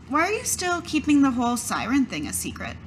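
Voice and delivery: normal talking voice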